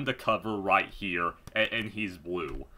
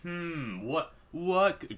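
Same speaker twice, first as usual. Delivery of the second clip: sarcastic; 6 semitones higher, much slower than usual. Acted. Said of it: "I'm not even gonna do that sarcasm".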